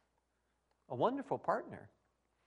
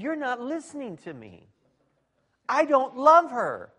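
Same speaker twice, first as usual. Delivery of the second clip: frustrated outburst; 9 semitones higher, 14 dB louder than usual. Retold or imitated. Man frustrated with pastor, loud exasperated. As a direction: push it out louder in frustration. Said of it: "he starts to get frustrated with me".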